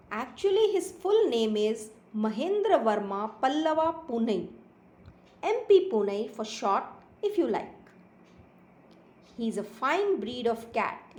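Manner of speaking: slow, lilting storybook reading; story read-aloud. A narrator's reading. Teacher reading a kitten story to pupils, slow expressive narration. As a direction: read slowly with emphatic pitch swoops on key words.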